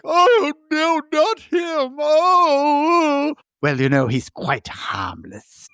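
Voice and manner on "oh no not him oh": much higher, slow frightened cry; terrified whimper. Retold or imitated. Host imitating the baron's terrified cry, high. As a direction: wail it in mock terror. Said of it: "Frankenstein's like".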